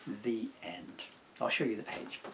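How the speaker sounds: ordinary delivery, nothing marked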